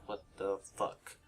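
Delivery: very soft, slow, low disbelief; hushed retold bewilderment; stunned undertone. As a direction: say it under your breath, at a loss. Reacting to friend breaking down.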